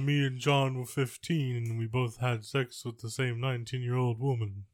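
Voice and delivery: a light Liverpool [name] voice; [name] impression. Mocking a [name] play to a friend, light Liverpool accent. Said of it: "your [name] is awful".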